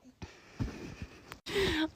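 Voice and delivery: a high, airless burst of laughter; can't hold back a giggle; high breathless laugh. Real, not acted. Laughing at her own story to viewers, real giggle. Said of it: "I'm sorry, this is so funny".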